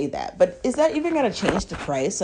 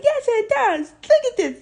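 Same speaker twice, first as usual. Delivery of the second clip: upset protest. Retold or imitated. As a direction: insist through tears, pitch flying up. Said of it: "And I'm just upset".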